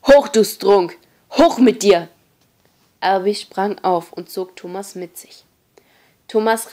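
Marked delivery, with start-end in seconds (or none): 0.1-2.1 loud, forceful command; barked order. A narrator's reading. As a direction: bark it at a newcomer. Leader drags newcomer to his feet. (lauter, barscher Befehl)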